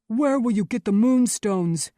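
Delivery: loud, high challenge; demanding. A narrator's reading. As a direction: demand an answer gruffly from an opponent. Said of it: "Baxter demanded".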